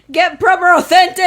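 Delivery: laughing. Real, not acted. Laughing repeat of phrase.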